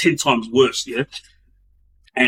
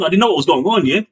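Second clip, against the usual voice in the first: normal talking voice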